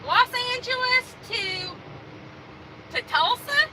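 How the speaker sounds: slow, high, exaggerated drawl; imitating his accent. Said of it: "and he said".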